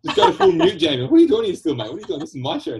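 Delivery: laughing. Real, not acted. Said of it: "I gotta turn my microphone down".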